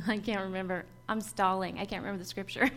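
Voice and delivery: low, soft laugh through the words; laughing admission. Real, not acted. Preacher admits to listeners she can't remember the scripture, laughing. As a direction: chuckle at your own memory slip.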